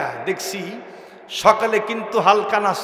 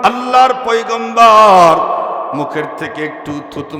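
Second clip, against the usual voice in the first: full-force preaching; 3 semitones higher, 5 dB louder than usual. Acted. Prophet orders Jaber to lift the lid, ringing preaching. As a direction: ring out the order, holding it long and loud.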